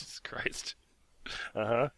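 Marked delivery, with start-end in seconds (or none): 0.0-0.7 whispered aside. Real, not acted. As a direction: breathe it under your breath. Unvoiced aside.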